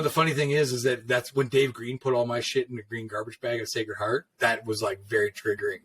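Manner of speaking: ordinary talk, nothing marked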